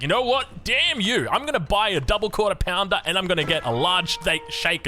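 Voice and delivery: hammed-up fury at mum; mock rage. Telling viewers about rage-buying McDonald's, in mock angry outburst.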